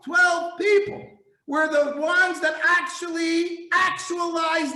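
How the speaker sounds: high, loud fervent preaching; preacher thundering to the congregation; fervent climax. Real, not acted. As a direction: preach it at full force.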